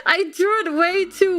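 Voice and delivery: high, cracking laughter through the words; squealing with laughter at the emote; laughing hard. Real, not acted. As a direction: crack up at your own drawing. Laughing at her own emote.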